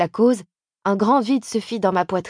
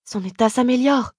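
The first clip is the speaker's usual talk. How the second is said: higher-pitched, anxious protest; fearful, uneasy; 5 semitones higher than usual. A narrator's reading. Sister-in-law insisting the patient is improving, fearful and uneasy. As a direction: plead with fear in the voice. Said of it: "d'une voix que la peur et le malaise faisaient trembler".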